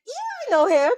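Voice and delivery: high, loud mock-eager reply; retold excited recognition.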